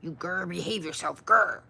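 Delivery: growling and scolding the computer playfully; mock scolding growl. Streamer scolding the thumbnail tool, playful growling. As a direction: tell the machine off like a naughty pet. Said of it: "I'm just gonna grr at it now".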